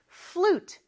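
"flute" said as a single syllable, no vowel after f; English flute in one beat. Shown as a demonstration. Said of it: "하나의 음절로 발음해 주세요".

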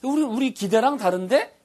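a lightly acted surprised customer; mock surprise. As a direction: sound caught off guard. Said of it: "예상한 대로가 아니다".

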